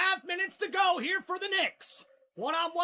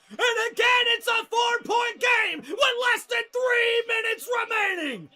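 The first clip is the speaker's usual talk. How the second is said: big play-by-play call, loud and high; thrilled commentator yelling the basket; excited shout; 4 semitones higher, 7 dB louder than usual. Real, not acted. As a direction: roar it as the shot drops. Clutch three, play-by-play call.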